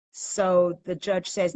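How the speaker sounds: ordinary delivery, nothing marked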